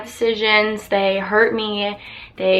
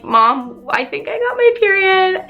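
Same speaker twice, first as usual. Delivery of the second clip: telling mom; 10 semitones higher than usual. Retold or imitated. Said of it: "I was like".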